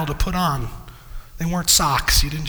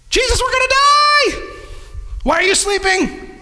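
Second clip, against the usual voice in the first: panicked disciples. Retold or imitated. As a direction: wail at the sleeping master in terror. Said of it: "disciples in the boat".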